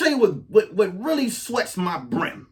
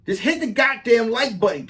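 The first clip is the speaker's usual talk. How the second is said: usual speaking voice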